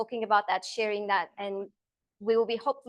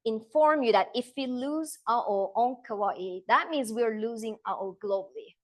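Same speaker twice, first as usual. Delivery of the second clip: deliberately slow, weighty emphasis; slow right down to stress the point. Real, not acted. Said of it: "I just want to slow down here".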